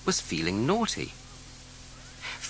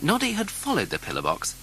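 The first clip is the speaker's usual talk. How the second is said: usual speaking voice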